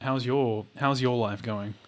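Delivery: ordinary delivery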